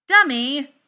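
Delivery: loud, high scolding call; exclaiming shout. Acted. Tony yelling at the bot that toppled Thor, loud shout. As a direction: yell the name sharply.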